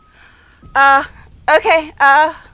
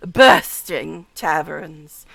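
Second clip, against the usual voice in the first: "bursting taverns" drawn out very slowly, "bursting" heavily stressed; lower, slow mock-seductive "bursting taverns"; imitating Astarion's enunciation; 8 semitones lower, much slower than usual. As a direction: savour "bursting" the way he does. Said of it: "this selective enunciation".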